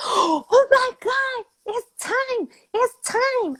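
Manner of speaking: high, slow, crying panic; hammed-up high panicked cry; panicked shriek. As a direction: shriek it like the baby's here now. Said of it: "comes running out my mom's room screaming".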